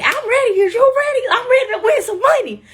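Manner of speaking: high, loud, laughing hype; pumped up. Retold or imitated. Contestant pumping herself up before the game, high and laughing. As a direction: psych yourself up to win.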